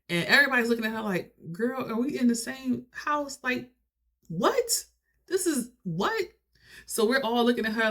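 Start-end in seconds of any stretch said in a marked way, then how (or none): 1.5-6.2 high-pitched, baffled questioning; incredulous disbelief. Retold or imitated. Relatives looking at D in the unfinished house, incredulous. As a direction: act out stunned disbelief, pitch climbing. Acted family disbelief at her claims.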